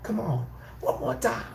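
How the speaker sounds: high-pitched mocking impression; put-on high voice of a defeated man; imitating the dude. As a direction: plead it high, mimicking him. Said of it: "dude said like this".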